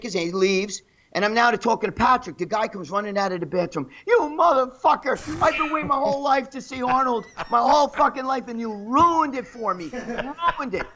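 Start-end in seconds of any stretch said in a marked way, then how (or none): 4.1-10.9 higher-pitched, anguished mock accusation; imitated freak-out. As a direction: act out his outraged complaint, pitched up. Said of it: "He was freaking out".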